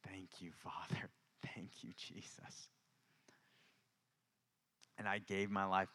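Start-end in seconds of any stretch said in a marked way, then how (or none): 0.1-2.7 soft, almost voiceless thanks with a small laugh; whispered prayer. Real, not acted. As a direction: whisper it to God, laughing gently.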